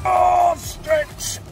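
usual speaking voice

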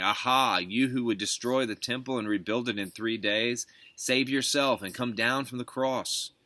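narrator's mild mocking call; derision. A narrator's reading. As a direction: jeer as a passerby, lightly acted. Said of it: "those who passed by derided him".

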